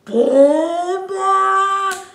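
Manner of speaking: shouted mock impact booms; loud boom boom. Narrator punctuating the shock, loud shouted booms. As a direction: bang out the booms like a shock hitting. Sound effect in story.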